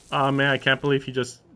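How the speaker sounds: ordinary delivery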